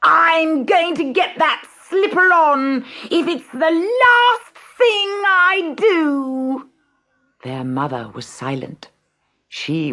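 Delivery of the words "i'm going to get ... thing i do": high, loud, slow shriek. A narrator's reading. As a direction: shriek like a vain stepsister. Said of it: "shrieked Gertrude".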